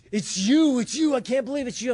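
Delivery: high, loud gushing disbelief; excited recognition. Retold or imitated. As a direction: imitate a thrilled stranger spotting his hero. Old acquaintance recognizes him, overjoyed.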